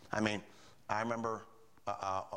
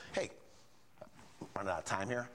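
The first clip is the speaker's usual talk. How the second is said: ordinary talk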